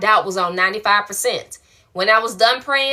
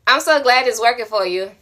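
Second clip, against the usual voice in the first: ordinary talk, nothing marked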